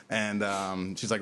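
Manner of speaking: usual speaking voice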